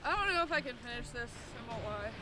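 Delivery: eating sour candy. Real, not acted. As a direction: mouth puckered from sour candy. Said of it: "Oh, my mouth is like dialed".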